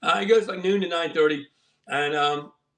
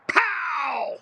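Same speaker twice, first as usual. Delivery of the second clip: excited reveal; 9 semitones higher than usual. Real, not acted. Big reveal of deck.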